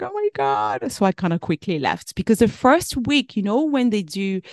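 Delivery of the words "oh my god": high, dismayed gasp of a mother; a squeaky, startled "oh my God". Retold or imitated. Mom leaving crying daughter at nursery.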